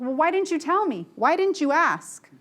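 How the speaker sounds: half-mock, half-real frustration at the employee; frustrated boss. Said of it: "because I'm pissed off".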